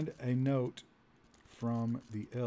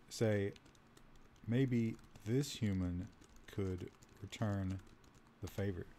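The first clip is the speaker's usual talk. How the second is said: sultry elf voice; 4 semitones lower, 7 dB quieter, slower than usual. Acted. Elf propositions the player.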